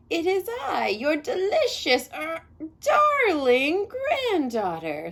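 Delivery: high, slow put-on girl's voice, slipping on delicious; the wolf squeaks up high to pass as the girl; wolf faking a high voice. A narrator's reading. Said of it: "said the wolf with a high voice".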